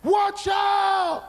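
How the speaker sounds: high, full-force warning scream; huge, drawn-out high shout; top-of-the-lungs yell. Retold or imitated. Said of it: "At the top of my lungs".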